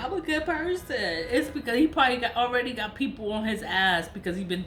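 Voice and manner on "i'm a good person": higher mocking voice, sing-song; mocking the spiritualist. Vlogger mimicking the spiritualist's claim, higher mocking voice. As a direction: mimic his fake piety, higher. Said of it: "I don't know how many times he said that".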